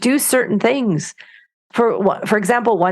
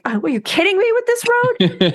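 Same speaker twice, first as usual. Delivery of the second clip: much higher, incredulous outburst; exasperated doctor; 13 semitones higher than usual. Retold or imitated. Doc Martin's Londoner griping about Cornwall roads, high incredulous. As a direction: play the city doctor appalled by the road. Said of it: "And he's like".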